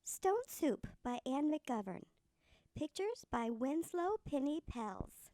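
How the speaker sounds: plain reading voice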